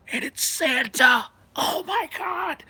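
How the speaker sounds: high, slow, breathless squeal; squealing excitement. Retold or imitated. A kid realizes it's Santa raging, high squeal. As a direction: squeak it out in shocked delight.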